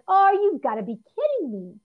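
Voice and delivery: high, loud, fed-up groan of words; exasperated disbelief. Retold or imitated. Girlfriend stopped by police yet again, loud exasperated disbelief. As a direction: here we go again. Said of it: "I'm like".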